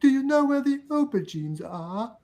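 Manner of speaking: a friend dared into imitating a British shopper with the accent. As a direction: put on a posh-ish British shopper's accent. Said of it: "Try it, [name]".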